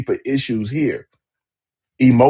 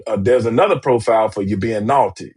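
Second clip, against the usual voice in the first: usual speaking voice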